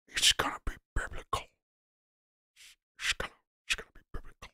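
whispered, breathy Gerard Butler. Acted. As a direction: hush it like a grim movie line. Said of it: "the best Gerard Butler impression".

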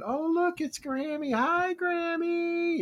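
high, drawn-out cheerful crowd greeting; excited greeting. Retold or imitated. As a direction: call out to her like old friends spotting her. Crowd greeting Grammy off the boat.

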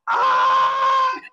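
high, loud gleeful scream; an ambitious squeal of joy; gleeful shriek. Retold or imitated. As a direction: shriek with wicked delight. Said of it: "the Slytherin ambition part of me is going".

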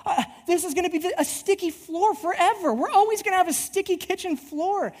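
hammed-up alarm over a sticky floor; mock panic. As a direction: retell the freak-out in a high panicky voice. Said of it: "I was freaking out".

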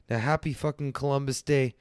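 usual speaking voice

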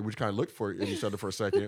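ordinary talk, nothing marked

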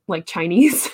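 ordinary delivery

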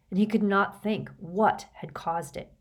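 usual speaking voice

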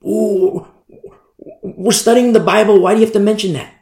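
whiny objector put on for mockery; mock objection. Acted objector interrupting the preacher.

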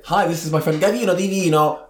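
put-on Italian accent. Guest introducing a friend, Italian accent. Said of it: "say it in an Italian accent".

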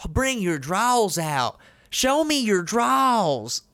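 slow, theatrical Southern drawl, climbing high; overdone Southern drawl. Shown as a demonstration. Mocking an actress's forced accent with a big Southern drawl. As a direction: drag the vowels in a fake Southern twang. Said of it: "You don't need to bring your draws out".

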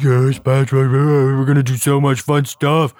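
high, goofy cartoon voice; Patrick. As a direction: imitate an excited dopey sidekick. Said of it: "And Patrick's like".

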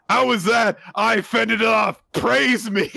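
high, loud, boastful kaiju; cocky high voice. Retold or imitated. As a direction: brag like a gleeful show-off.

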